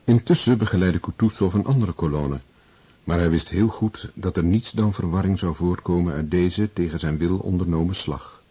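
normal talking voice

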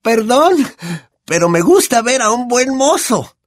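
high, brash, boozy aunt voice; Aunt Marge. Acted. Aunt sneers at the family over brandy, high brash voice. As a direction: play a loud, tipsy, condescending old aunt.

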